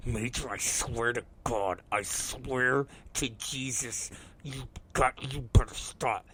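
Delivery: low-volume slow warning, holding it in; hushed parental threat. Retold or imitated. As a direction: threaten the kid under your breath. Dad warning son under breath.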